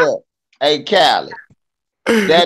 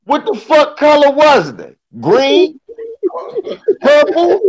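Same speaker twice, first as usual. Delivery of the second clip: louder and way higher, sarcastic; mocking disbelief; 13 semitones higher, 4 dB louder than usual. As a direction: toss out silly colors to mock him. Mocking retort to a racist visitor.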